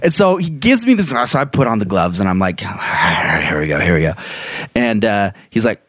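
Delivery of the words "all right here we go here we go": low, resigned self pep talk; bracing himself, lower voice; mock psych-up. Bracing to fight a crazy kid, low mock pep talk.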